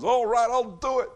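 high, loud, over-eager agreement; hammed-up cheerful consent; mock eager yes. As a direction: play the ruler who would gladly give it all. Mock answer then "No.

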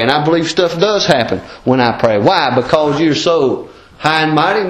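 loud, driving preacher's cadence; full-force preaching. Acted. Pressing the congregation to pray fervently, loud preaching. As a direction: preach it hard to the pews.

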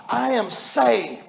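drawn out, slow and deliberate; slow emphatic. Acted. Preacher sealing his point, slow and deliberate. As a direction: let each word land slowly. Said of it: "slowed emphatic close".

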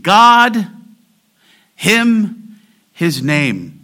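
"God" loud and drawn out, "him" high, "his name" easing down; emphasized nouns. Shown as a demonstration. Preacher lists the emphasized noun, weighting "God", "him", "his name". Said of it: "common Hebrew function to emphasize".

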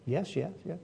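very soft, submissive yeses; acted cowed reply; meek agreement. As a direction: concede quietly, not daring to argue. Cowed Naboth answering Jezebel, acted.

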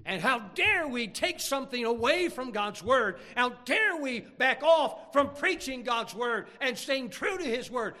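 loud, high, drawn-out indignation; slow, forceful pulpit outcry; full-force preaching. Real, not acted. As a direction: thunder a challenge at the congregation.

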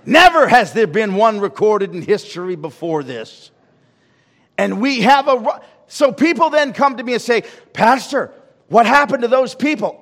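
high, loud sermon peak; preaching at full force. Acted. Preacher answers the congregation's question, full-force preaching. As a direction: drive the point home at full voice.

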